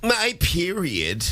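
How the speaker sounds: drawn-out joke. Pretend. Joking excuse.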